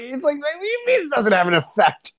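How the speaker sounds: high, disbelieving outburst; mock outrage at a silly claim; incredulous. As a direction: throw the question back in disbelief. Retelling his incredulous reply.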